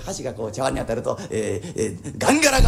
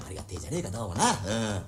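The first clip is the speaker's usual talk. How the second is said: quiet musing; 8 semitones lower, 8 dB quieter than usual. Acted. Bachelor muses alone, quietly.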